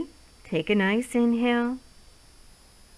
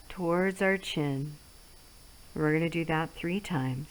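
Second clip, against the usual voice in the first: guided relaxation; 2 semitones lower, 4 dB quieter than usual. Acted. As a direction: ease them through each stroke softly. Leads guided face massage.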